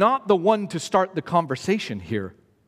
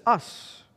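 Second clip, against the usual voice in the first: us set apart, softer; stressed us; 2 semitones higher, 5 dB quieter than usual. Shown as a demonstration. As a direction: isolate the word us, quietly. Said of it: "Notice the demon says".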